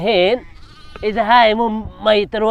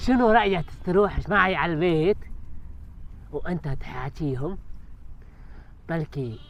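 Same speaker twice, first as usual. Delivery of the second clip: timid hushed request; 6 semitones lower, 12 dB quieter than usual. Acted.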